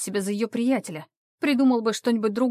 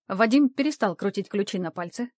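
usual speaking voice

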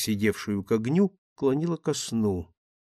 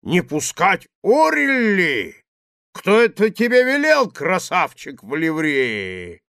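louder, higher, slow rough bellow; coarse drunken roar; 6 semitones higher, 6 dB louder than usual. Acted. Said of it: "Грубым ... голосом кто-то ревел".

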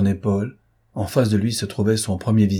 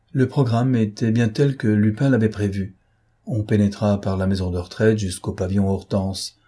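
ordinary delivery, nothing marked

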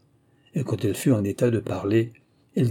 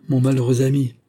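louder, pained exclamation; crying out; 3 semitones higher, 5 dB louder than usual. A narrator's reading. Louis lamenting his unhappy friend, crying out. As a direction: exclaim in distress. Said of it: "s'écria Louis".

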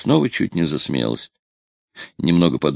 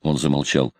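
ordinary delivery

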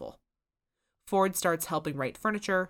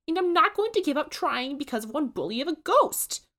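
higher, louder earnest plea; Susan pleading; 10 semitones higher, 6 dB louder than usual. A narrator's reading. Susan defends her diner to Ford and Dipper, high earnest plea. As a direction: plead your case, near tears.

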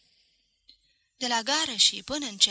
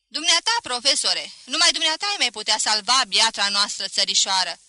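fervent exhortation; 8 dB louder than usual. A narrator's reading. Supporter egging on the professor before the crowd, fervent exhortation. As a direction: urge him on forcefully.

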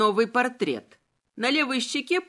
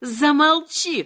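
loud, higher-pitched outburst; shouted command; 4 semitones higher, 6 dB louder, slower than usual. A narrator's reading. Woman silencing her opponent, shouted. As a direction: shout it as an order to silence him. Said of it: "крикнула она".

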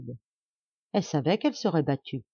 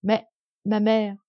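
timid protest; 4 semitones higher, 4 dB louder, slower than usual. Acted. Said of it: "se hasarde timidement".

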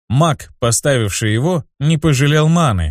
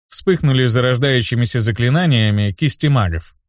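ordinary delivery

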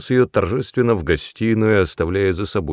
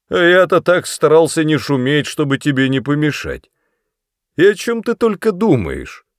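put-on indignant drunk father; 7 semitones higher, 5 dB louder than usual. A narrator's reading. Drunk father reproaches daughter.

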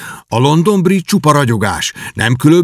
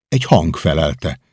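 plain reading voice

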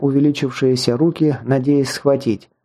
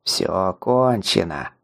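голос некроманта; 5 semitones lower, 3 dB quieter, slower than usual. Acted. Некромант душит героя.

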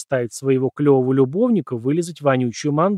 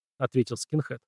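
plain reading voice

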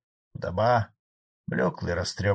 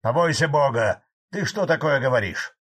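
higher, mildly shouted scolding; shouted reproach; 4 semitones higher, 5 dB louder than usual. A narrator's reading. Frolov shouts from his seat at Fadey, reproachful. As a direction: scold him from across the room. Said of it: "буквально закричал со своего места Фролов".

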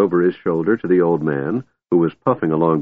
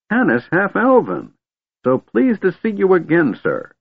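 put-on very high-pitched, delighted old man; beaming delight; 12 semitones higher than usual. A narrator's reading. Old man recognises his friend Tanis, beaming delight. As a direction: greet him joyfully in a high voice. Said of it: "Fizban beamed".

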